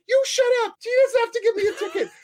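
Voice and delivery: very high, loud shriek, fast, laughing through it; parked driver screaming back at the truck, very high. Retold or imitated. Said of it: "the person's like".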